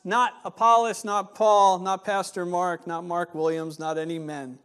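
ordinary talk

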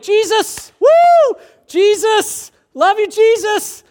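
ecstatic high shouting praise, hammed up; mock church enthusiasm. Churchgoer praising Jesus inside the church, ecstatic mock shout. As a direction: gush over Jesus like a fan screaming at a concert. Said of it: "But in church, we're like".

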